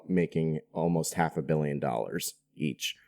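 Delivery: ordinary delivery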